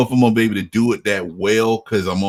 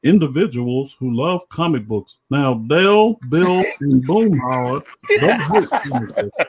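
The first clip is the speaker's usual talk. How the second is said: Southern cartoon dad voice, raised; Hank Hill; 4 semitones higher than usual. Guest talks comic books in character, Hank Hill voice. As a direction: talk about the buddies as the cartoon dad. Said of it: "do the Hank Hill impression".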